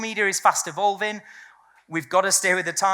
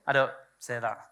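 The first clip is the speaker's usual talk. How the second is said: ordinary delivery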